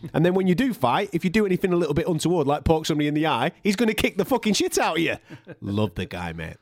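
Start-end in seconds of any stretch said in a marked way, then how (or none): none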